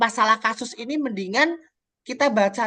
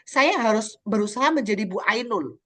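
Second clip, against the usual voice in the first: usual speaking voice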